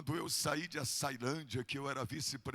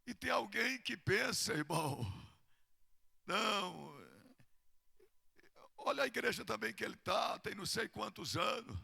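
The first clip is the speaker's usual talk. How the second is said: usual speaking voice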